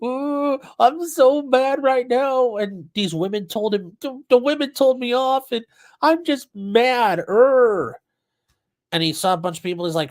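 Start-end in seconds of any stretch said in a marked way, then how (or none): 0.0-8.0 high, whiny crybaby voice, drawn out slowly; mock him as a sulking big baby; whiny mock tantrum. Streamer mocking the defendant's tantrum, high whiny crybaby voice. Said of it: "and he's just like".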